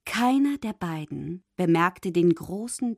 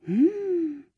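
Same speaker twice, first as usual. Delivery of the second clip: curious; 11 semitones higher, slower than usual. A narrator's reading. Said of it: "fragte sie".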